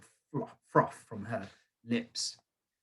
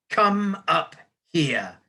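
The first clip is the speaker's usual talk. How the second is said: slow, drawn-out shouted command; shouted order; 6 semitones higher, 6 dB louder, much slower than usual. A narrator's reading. Headmistress ordering boy onstage, slow shout. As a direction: bellow him up to the front. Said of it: "the Trunchbull shouted".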